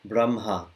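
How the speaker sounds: "Brahma" pronounced brum-ha, H after M. Shown as a demonstration. Teacher confirming for student, "Brahma" said as brum-ha. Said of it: "as if the H was after the M".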